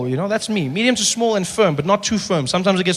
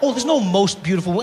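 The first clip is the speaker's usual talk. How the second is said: ordinary talk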